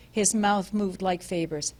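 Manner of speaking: plain reading voice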